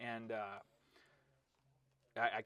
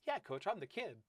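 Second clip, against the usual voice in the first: put-on high-pitched kid's reply; imitated young kid; 8 semitones higher than usual. Tiny freshman answering his coach, imitated kid voice.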